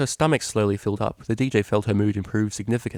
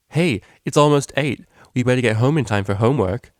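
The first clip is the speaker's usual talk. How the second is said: loud, higher mock scolding; teasing mock-prim; 4 semitones higher, 7 dB louder than usual. A narrator's reading. Vinyl loudly mimicking her studious roommate. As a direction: tease her by playing the dutiful student.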